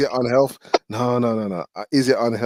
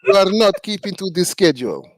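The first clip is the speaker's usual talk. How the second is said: put-on Russian accent, higher; 9 semitones higher than usual. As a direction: play a stern Russian. Joining the Russian bit.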